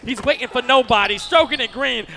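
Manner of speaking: ordinary delivery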